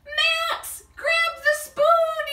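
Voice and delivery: shouting to a friend in the pot; yelling. Acted. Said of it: "yelled Dakota".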